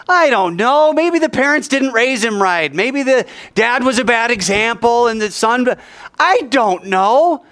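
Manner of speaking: high-pitched flustered guessing; acted worked-up bafflement; exasperated. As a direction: throw out answers in frustration, pitched high. Angry student guesses at the answer.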